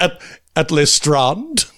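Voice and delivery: "Strand" drawn out slowly with a long ah; mock-fancy Strand. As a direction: tease with a Frenchified broad-A "Strand". Mocks the other's pronunciation.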